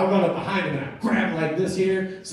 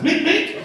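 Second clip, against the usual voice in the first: two very high, loud squeaks; imitated punch noises; 14 semitones higher, 6 dB louder than usual. Driver hits him two more times, squeaked punch effects. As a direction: squeak out each cartoon punch.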